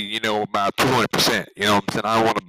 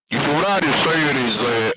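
normal talking voice

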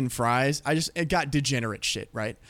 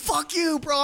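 high mock-angry retort; retold screaming; 10 semitones higher, slower than usual. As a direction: imitate a screaming match without full volume. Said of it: "he's screaming at me".

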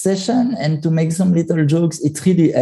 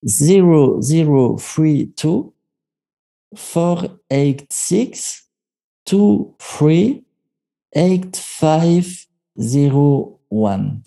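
slow, digit by digit dictation; reading digits one by one; much slower than usual. Shown as a demonstration. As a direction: spell out each number slowly for writing down. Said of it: "do you want me to, to give it?".

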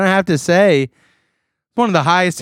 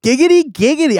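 high "giggity, giggity" in a cartoon voice; mock Quagmire "giggity"; Quagmire catchphrase; 12 semitones higher, 3 dB louder, faster than usual. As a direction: squeal it out like the cartoon guy. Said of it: "I say".

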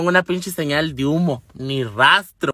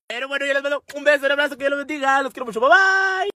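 despedida cantarina, muy aguda y rápida; despídete con vocecita aguda y melosa de video; despedida fingida; 6 semitones higher, faster than usual. Acted. Imita una despedida cantarina. (vocecita aguda de despedida)

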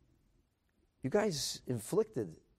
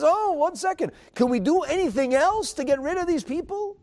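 much higher and louder, a sudden eager offer; mock eager king; 12 semitones higher, 8 dB louder than usual. Achashverosh abruptly offering Esther more, hammed-up eagerness. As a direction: play the king jumping in keen for more. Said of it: "all of a sudden he says".

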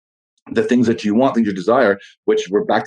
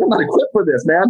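high mock-panicked cry, nearly a whine; 6 semitones higher than usual. Host imitating someone facing a dragon, mock panic. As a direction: play the overwhelmed fool in the dragon pit. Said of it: "You're like".

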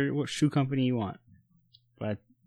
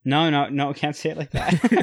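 higher-pitched objection breaking into laughter; laughing protest; 5 semitones higher, 3 dB louder than usual. Real, not acted. Co-host stops host's awkward children remark, laughing protest. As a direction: protest while starting to laugh.

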